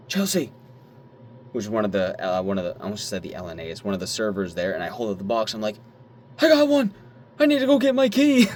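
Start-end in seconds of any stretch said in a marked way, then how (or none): 0.1-0.5 high excited call across the room. Retold or imitated. Calling coworker excitedly. 6.4-8.5 very high, loud thrilled squeal; excited squeal. Retold or imitated. Excited retold shout at work.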